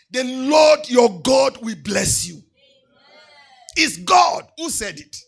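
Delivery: loud, chanting preacher's cry over music; thundering pulpit declaration of blessings; full-force preaching. Acted. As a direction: proclaim every blessing to the congregation at full force.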